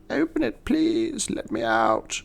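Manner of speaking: put-on very high, thin little-girl plea; hammed-up ghostly little girl. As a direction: squeak it like a trapped child. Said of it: "the voice of a disembodied little girl".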